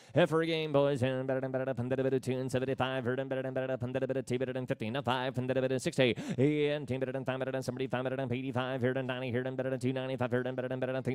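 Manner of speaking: rapid rolling auction chant; auctioneer. Acted. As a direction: rattle off bids in a fast singsong. Livestock auction bid calling.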